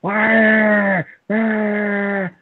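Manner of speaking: high, loud mock wailing wah; mocking crybaby wail; mock crying. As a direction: whine it like a crybaby, hammed up. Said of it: "everybody's like".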